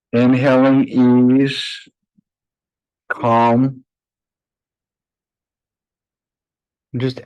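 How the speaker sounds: unhurried, even meditation voice with long pauses; guided meditation. Acted. Yoga teacher guiding her class to close their eyes, calm meditation voice. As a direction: ease the class inward, one phrase at a time.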